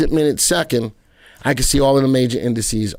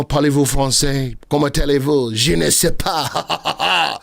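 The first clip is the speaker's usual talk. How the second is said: mock French. Shown as a demonstration. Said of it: "That's all my French".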